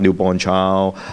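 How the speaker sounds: ordinary talk, nothing marked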